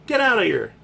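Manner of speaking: normal talking voice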